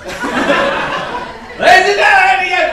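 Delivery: high, drawn-out announcer call; hammed-up grand greeting to the audience; mock show announcer. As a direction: stretch it out like a ringmaster. Mock formal opening to the crowd.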